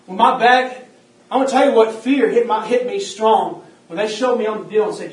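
loud testimony from the pulpit; preaching at full force. Acted. Preacher telling his back injury, preaching loud. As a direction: drive the story out to the room.

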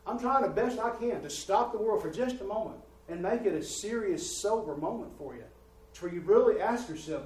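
high, loud pulpit preaching; preaching at full force. Acted. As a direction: drive it home to the congregation.

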